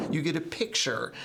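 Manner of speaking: ordinary delivery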